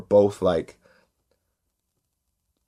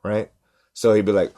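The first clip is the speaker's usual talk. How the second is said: normal talking voice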